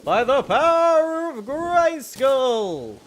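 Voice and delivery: slow booming hero cry; heroic proclamation. Pretend. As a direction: declare it like a cartoon hero. Famous heroic catchphrase.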